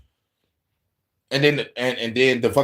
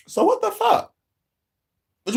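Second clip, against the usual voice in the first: much higher, cracking with disbelief; exasperated outburst. Retold or imitated. Fed up with her saying no to everything, high exasperated cry. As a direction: squeak it out in disbelief. Venting at partner's refusals.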